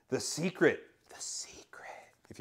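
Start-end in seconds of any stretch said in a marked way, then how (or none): 1.1-1.9 a hushed, conspiratorial playful whisper; mock secretive whisper. Pastor teasing about a bestseller title, mock secretive whisper. 2.3-2.4 hushed, playful whispering all through; mock conspiratorial whisper. Pastor joking about Oprah's favorite book, mock whisper.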